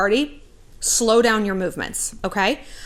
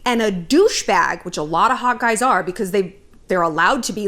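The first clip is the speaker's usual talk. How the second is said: ordinary talk, nothing marked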